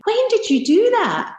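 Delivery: high-pitched amazed question; mock-excited, voice leaping up; imitating excited coach. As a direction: play the coach's excited curiosity. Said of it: "He's all excited".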